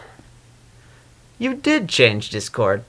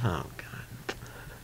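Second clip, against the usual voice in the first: breathy whisper under the breath; whispered aside; 10 semitones lower, 20 dB quieter than usual. Acted. Muttering aside while Celestia boasts. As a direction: mutter it to yourself, barely voiced.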